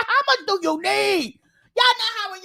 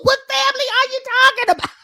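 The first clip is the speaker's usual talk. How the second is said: higher, louder disbelieving questions; incredulous outburst; 6 semitones higher, 6 dB louder than usual. Real, not acted. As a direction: press her loudly in disbelief. Challenging her story.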